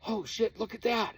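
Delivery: high, loud startled cry; startled exclaim. Retold or imitated. Friend startled under the night sky, high loud exclaim. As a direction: blurt it out like you just spotted something. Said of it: "the one guy goes".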